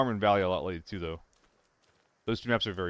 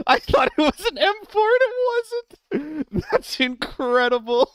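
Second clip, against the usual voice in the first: much higher, loud incredulous; excited disbelief; 5 dB louder than usual. Real, not acted. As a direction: squeal in amazed disbelief. Disbelief at enemy gun.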